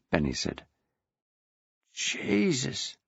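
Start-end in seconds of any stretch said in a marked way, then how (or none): 1.9-3.0 hissed. A narrator's reading. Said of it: "Annie hissed".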